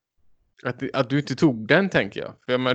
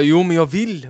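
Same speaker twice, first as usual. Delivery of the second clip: louder, higher mock insistence; insisting; 4 semitones higher, 5 dB louder than usual. Imitating the woman insisting on what she wants, insistent. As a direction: imitate her pushing for it. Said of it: "Och hon bara".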